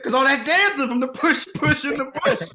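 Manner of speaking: a hint of Leslie, laughing it off; Leslie explaining. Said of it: "She said".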